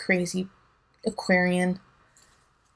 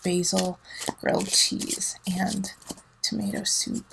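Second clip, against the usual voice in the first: soft whisper; 3 semitones lower, 6 dB quieter than usual. Real, not acted. Listing dinner menu softly.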